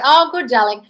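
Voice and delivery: mock gushing. Mock approval of listener's comment.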